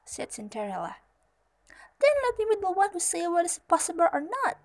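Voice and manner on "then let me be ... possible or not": high, loud, fast insistence; assertive fairy godmother. A narrator's reading. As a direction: take charge as an affronted fairy. Huffy fairy godmother takes charge.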